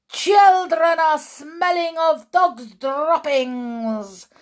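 high, loud, slow screech; witch screech. Acted. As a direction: screech it with disgust. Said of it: "screeched the Grand High Witch".

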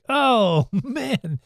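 high, laughing mock condolence; laughing sympathy. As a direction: say sorry while cracking up. Retold movie, driver sympathizing.

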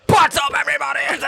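loud, high hyped-up YouTuber shout; YouTuber intro. Shown as a demonstration. Cohost demonstrating a YouTuber greeting, hyped shout. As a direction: blast it like a vlogger opening. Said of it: "Try a YouTuber".

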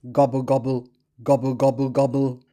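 lightly voiced turkey gobble; gobbling turkey voice. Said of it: "Turkey says".

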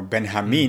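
Benjamin as "ben-ha-MEEN"; Spanish pronunciation. Shown as a demonstration. Coach introducing himself in Spanish, Benjamin pronounced ben-ha-MEEN. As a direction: say Benjamin with a Spanish h-like j and stressed last syllable. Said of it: "Spanish pronunciation of my name".

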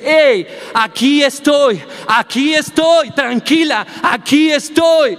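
calm your scared daughter, drawing out each word; reassuring father. Retold or imitated. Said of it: "toma su cabeza y le dice". (un papá calma a su hija asustada: tranquilizador y lento, más agudo)

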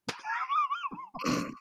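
high, soft wheezy laugh. Real, not acted. Improviser losing it after the scene, high squeaky laughter. Guest breaks up as show ends.

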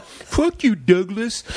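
high, snapping comeback; angry kid. Retold or imitated. Jeffrey snaps back at Douglas, high angry retort. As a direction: throw it back at him like a sore loser.

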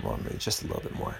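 a guilty little whisper to yourself; whispered temptation. Retold or imitated. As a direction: sneak the words out under your breath. Retold self-talk giving in to temptation.

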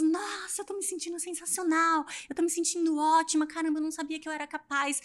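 lightly voiced student amazed at herself; thrilled student. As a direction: gush about how great you feel. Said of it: "Aí elas".

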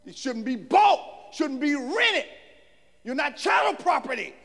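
emphatic preacher, punching key words; full-force preaching. Real, not acted. Preacher charging ministers to proclaim God's word, full-force preaching. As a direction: drive each charge home loudly.

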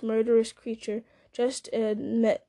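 ordinary delivery

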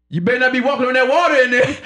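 mock stern stepdad. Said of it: "Joseph just sit in the living room like".

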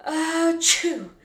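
big, loud, high-pitched sneeze; acted sneeze. A narrator's reading. Boy sneezing as he bounces along, loud acted sneeze. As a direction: burst out with a spelt-out achoo. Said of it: "Jack sneezed".

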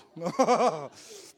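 loud, high rolling laugh; real laugh. Real, not acted. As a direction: roar at your own question. Laughs at own question.

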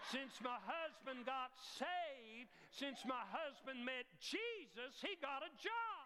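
slow, rising shout ending high on job; fervent shout. Shown as a demonstration. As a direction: proclaim it to the back row. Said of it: "Let me run that by you one more time".

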